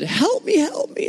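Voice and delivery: mock panicked plea, high-pitched; hysterical. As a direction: squeak out her plea for help like a terrified wife. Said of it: "she actually started going hysterical".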